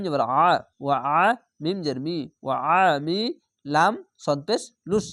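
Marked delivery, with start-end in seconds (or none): none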